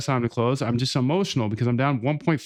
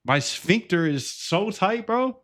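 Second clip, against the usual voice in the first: nervous squeak; 8 semitones higher, slower than usual. Real, not acted. Streamer tense over his trade, nervous high strained voice. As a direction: voice rising high and slow with tension. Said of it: "I'm nervous".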